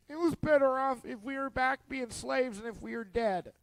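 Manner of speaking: mock despair. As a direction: sigh it out like a hopeless grumbler. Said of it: "You gotta be hungry or emotional to say".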